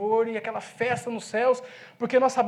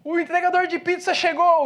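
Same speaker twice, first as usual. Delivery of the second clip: raised, louder announcing call; calling out an arrival; 5 semitones higher, 6 dB louder than usual. Retold or imitated. Pastor announcing to the church, a loud call. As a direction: shout the news to the whole church.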